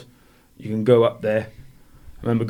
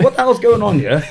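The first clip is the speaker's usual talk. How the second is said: high-pitched disbelief with a laugh; retold bewilderment; 10 semitones higher, 4 dB louder than usual. Boxer recalling his thoughts before the fight, amused bewilderment. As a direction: laughingly replay your bewildered panic.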